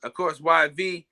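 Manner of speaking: ordinary delivery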